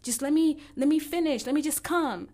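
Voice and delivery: a loud, whiny imitation of a man demanding to finish; mocking a pushy man. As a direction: ham up the pushy boyfriend who won't stop. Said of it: "and they go".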